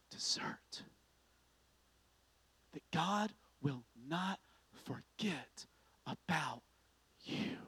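soft whisper, word by word with pauses; hushed sermon. Acted. Preacher hushing the congregation, whispered and slow. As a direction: draw them in with a whisper.

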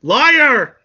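high, loud explosive yell; angry accusing shout. Acted. Anakin catches Padme out in a lie, angry high shout. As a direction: shout the accusation at full pitch.

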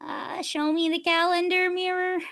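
small, high, uncertain voice; helpless asking. Acted. Guest trying names on a smart mirror, helpless. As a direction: call out tentatively, hoping someone answers.

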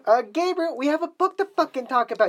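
very high-pitched, insistent reminder; exasperated insistence. Real, not acted. Pushing distracted co-host to the book.

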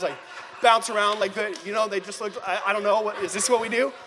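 ordinary delivery

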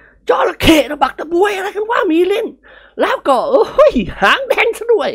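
put-on high old man's voice, exasperated; an old man fussing in alarm; alarmed old master. Old master scolding about the creature.

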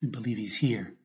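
normal talking voice

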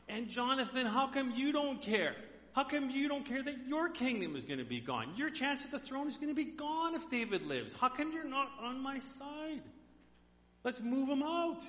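a jealous king berating his son; Saul ranting. Retold or imitated.

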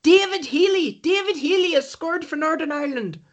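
high-pitched mock Northern Irish commentary; excited football commentator. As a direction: call the goal like an ecstatic commentator. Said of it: "hear the commentator going".

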